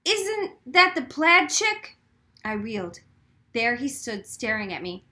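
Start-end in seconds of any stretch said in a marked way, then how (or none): 0.0-2.0 teasing high jeer, slow; mocking call. A narrator's reading. Daniel jeering at the girl, mocking call. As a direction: sneer it out at her. Bully spotting narrator, calling out.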